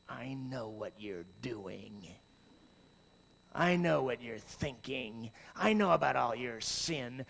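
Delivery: a judge who has caught you out; accusing God. Pretend. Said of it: "he goes".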